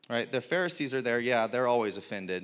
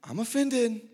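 mock offended disciple; 10 semitones higher, 4 dB louder than usual. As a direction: voice jumps high on the admission. Imagined Peter admitting he is offended.

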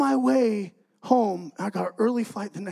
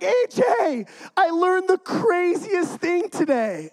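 high, loud, drawn-out excitement; fired-up little brother; 9 semitones higher, 4 dB louder, slower than usual. Retold or imitated. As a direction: imitate a brother bursting with news, pitched way up. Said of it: "he's all fired up".

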